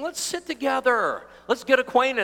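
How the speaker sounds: high, keen acted suggestion; eager invitation.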